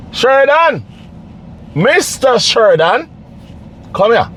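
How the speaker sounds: high, ringing call across the road. Real, not acted. Man calling homeless Sheridan over to talk, high hailing call. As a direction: hail him from a distance so he turns.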